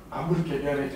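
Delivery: giggling, mock-sorry; sorry, said while laughing; laughing apology. Real, not acted. Teasing students, laughing apology.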